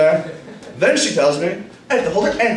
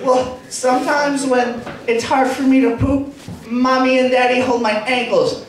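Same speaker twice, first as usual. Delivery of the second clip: put-on higher, little-girl explaining voice; five-year-old daughter; 5 semitones higher than usual. Daughter explains to babysitter how parents help, child voice. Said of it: "five-year-old daughter comes up and goes".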